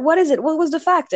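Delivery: high, urgent imitated questions; eager questioning. Another coworker demanding to hear the fact, eager.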